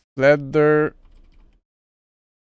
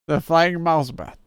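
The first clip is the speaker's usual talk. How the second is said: normal talking voice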